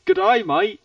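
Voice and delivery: high, loud mock Aussie greeting; Australian accent. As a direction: call it out in broad Australian. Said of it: "Whereas in Australia".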